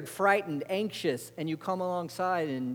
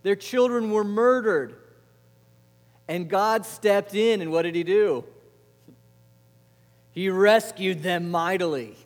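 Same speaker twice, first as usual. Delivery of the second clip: loud, raised, drawn-out preaching; full-force preaching; 4 semitones higher, 7 dB louder than usual. Real, not acted. Preacher recounting Israel's slavery and rescue, at full force. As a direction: hammer each phrase out to the congregation.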